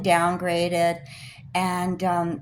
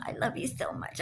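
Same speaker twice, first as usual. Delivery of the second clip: mock sweet whisper; 5 semitones higher, 8 dB quieter than usual. Mocking the friend's sweet talk.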